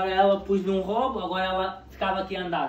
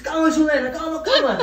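urgent calming; 5 semitones higher, 7 dB louder than usual. Retold or imitated. Retold urgent reply to panicking partner.

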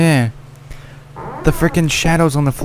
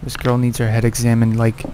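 ordinary delivery

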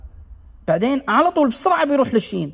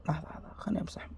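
whisper; 6 semitones lower, 15 dB quieter, much faster than usual. Real, not acted. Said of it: "aside while erasing".

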